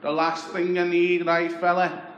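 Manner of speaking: butter him up like a chancer on the doorstep; Scouse beggar voice. Retold or imitated. Voicing local beggar Tony.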